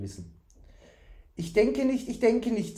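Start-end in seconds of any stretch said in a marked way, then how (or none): 1.4-2.8 high-pitched imitation of others' verdict; mocking quote. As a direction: parrot the naysayers in a raised voice. Fool quotes what people say.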